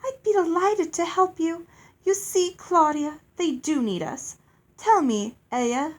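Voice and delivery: high, loud, gushing lady; delighted fairy. Acted. Fairy pleased to be asked for help, delighted. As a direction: trill with eager delight, pitch up.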